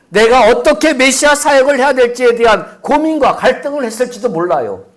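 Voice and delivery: loud, high impassioned sermon line; fervent preaching. Acted. Pastor preaching to congregation, loud and fervent. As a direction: preach it out with full fervour.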